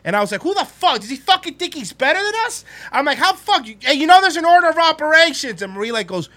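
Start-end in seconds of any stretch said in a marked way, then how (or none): 0.4-2.6 much higher, indignant replay; retold furious rant. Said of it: "I was so mad". 3.2-5.6 much higher, indignant replay; retold furious rant. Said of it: "I was so mad".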